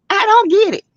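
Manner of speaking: high-pitched exclamation, baffled. Real, not acted. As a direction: cry out in disbelief. Exasperated over YouTube drama.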